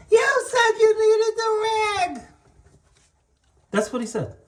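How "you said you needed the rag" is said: put-on high childish helper voice, much higher; helper's voice. Boss imitating his helper's defense in a high childish voice. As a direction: squeak it out like a clueless kid. Retelling the dripping rag mishap.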